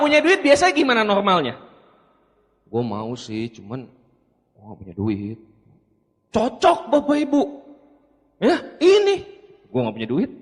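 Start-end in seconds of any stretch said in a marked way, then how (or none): 2.7-5.4 mocking the prospect. Presenter imitating a prospect, smugly claiming to have no money. Mimicking a smug broke prospect. 9.7-10.3 mocking the prospect. Presenter imitating a prospect, smugly claiming to have no money. Mimicking a smug broke prospect.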